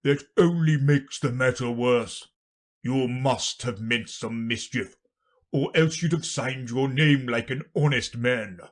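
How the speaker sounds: forceful royal reprimand; accusing king. Acted. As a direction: scold the prisoner pompously as a king. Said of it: "said the king".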